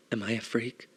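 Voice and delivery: ordinary delivery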